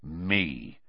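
a quiet, grim single word; soft, dark and grim. A narrator's reading. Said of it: "said Moody grimly".

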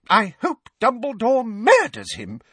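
high, bitter fury; mean every word; furious. A narrator's reading. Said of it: "said Missus Figg furiously".

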